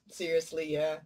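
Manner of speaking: normal talking voice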